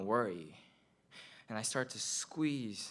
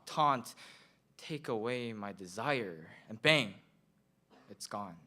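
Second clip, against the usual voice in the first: ordinary talk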